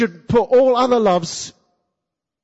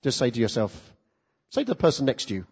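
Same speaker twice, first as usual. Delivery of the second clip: usual speaking voice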